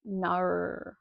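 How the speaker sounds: one drawn-out mock Australian "No."; mock the narrator's overdone Aussie "No."; bad Aussie "no". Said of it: "We don't say no like that".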